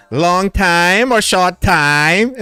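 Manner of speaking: a teasing inside-joke line; mock Thai accent. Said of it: "Short time, five hundred baht".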